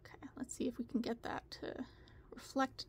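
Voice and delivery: whispered to herself; whispering. Real, not acted. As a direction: whisper while focused on a task. Mumbling while adjusting.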